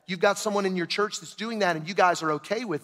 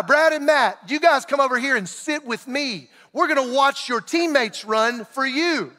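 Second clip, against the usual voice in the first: higher, mock-friendly coach voice, slowing at the end; sarcastic coach; 4 semitones higher than usual. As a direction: invite them over with sarcastic sweetness. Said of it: "and coach says".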